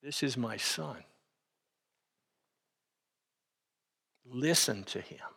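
soft and slow, as if hearing God speak; hushed, awed voice. Retold or imitated. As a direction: hush it, let the awe slow you down. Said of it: "And to hear that voice".

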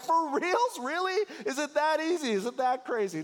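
can't believe he just agreed to that; incredulous disbelief. Retold or imitated. Pastor silently challenging the groom at the altar, mock incredulous. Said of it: "And I was like".

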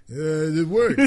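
very slow, loud dopey Rocky voice. Retold or imitated. Friend teasing Rocky's strategy, slow dopey Rocky voice. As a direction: drag it out like a dim boxer. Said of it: "Rock, you do that every single time".

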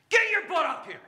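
very loud, high, blunt command; shouted order. Acted. Answering someone who feels not good enough, shouted command. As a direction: bellow it as a mock order. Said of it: "God doesn't talk like that".

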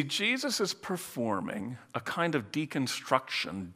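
ordinary talk, nothing marked

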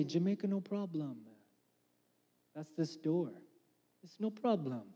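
a soft, lightly put-on Jamaican accent; bad Jamaican accent. Pastor mimicking a Jamaican phrase in a weak Jamaican accent. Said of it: "I do a really terrible accent".

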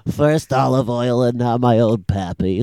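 lightly voiced Popeye; a faint put-on sailor grumble; hint of Popeye; Popeye moping. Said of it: "He goes".

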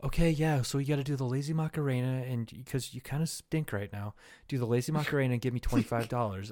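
lazy, hushed mock of a meek guy; soft-spoken mock. Mocking a soft-spoken guy, murmured. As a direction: drop low and soft, mocking his gentle tone. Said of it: "some soft-spoken fuck started coming at me like".

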